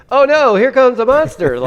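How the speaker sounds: loud, high, hammed-up alarm; mock fright. Imitating a non-actor in a monster movie, mock fright. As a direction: play a bad actor pretending to panic. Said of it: "He's just like".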